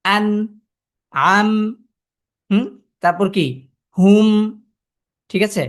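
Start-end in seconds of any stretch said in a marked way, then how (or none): 0.0-1.5 আন and আম closed firmly on the final consonant; আন, আম, each stopped on its nasal; sakin pronunciation. Shown as a demonstration. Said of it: "সাকিনের যে উচ্চারণ". 4.0-4.3 loud হুম closed on the m; হুম with the sound caught at the lips; sakin pronunciation. Shown as a demonstration. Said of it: "সাকিনের যে উচ্চারণ".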